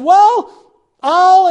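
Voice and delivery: loud, high, floundering for words; acted desperation. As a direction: grope desperately for a threat. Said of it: "You're desperate".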